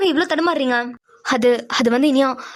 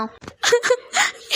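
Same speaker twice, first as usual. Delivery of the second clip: high broken sob-laugh; sobbing with relief at her sister's words; tearful sob; 8 semitones higher than usual. Acted. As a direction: let a sob catch in the throat. Pavi breaks down.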